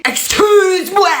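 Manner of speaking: high, drawn-out indignant cry; outraged disbelief. Acted. Mother shocked by son's cheek, outraged disbelief. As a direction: stretch it out slowly in high-pitched outrage.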